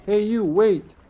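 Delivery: high, loud NPC call; video game character. Pretend. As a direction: shout it like a game guard. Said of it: "I sounded like a video game character".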